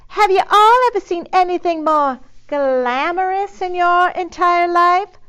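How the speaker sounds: high, loud Southern gush; gushing Texan drawl. Acted. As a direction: swoon over the dog in a Texas drawl. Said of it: "gushed Eugenia".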